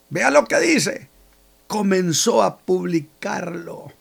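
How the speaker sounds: usual speaking voice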